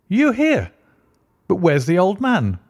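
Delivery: high, startled disbelief; astonished. A narrator's reading. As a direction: caught completely off guard. Watson astonished at Holmes.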